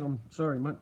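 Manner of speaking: ordinary talk, nothing marked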